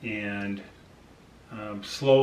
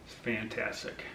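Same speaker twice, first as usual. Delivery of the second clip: emotional; 11 dB quieter than usual. Real, not acted. Telling viewers the withdrawal headache ended, softly choked up. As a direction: voice dropping soft and choked up. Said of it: "Sorry, I get a little emotional here".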